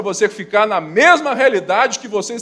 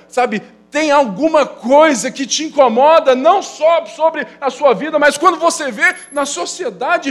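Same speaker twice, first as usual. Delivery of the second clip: higher and louder impassioned preaching; fervent preaching; 8 semitones higher, 6 dB louder than usual. Acted. Pastor stirring the congregation about the troubles of Belo Horizonte, fervent preaching. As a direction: preach it out with rising fervour.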